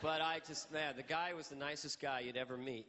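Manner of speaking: normal talking voice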